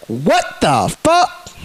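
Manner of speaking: slow, low, drawn-out what the fuck; lightly acted dumbfounded tourist; slow stunned disbelief. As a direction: let the shock sink in slowly. Foreigner stunned by cops.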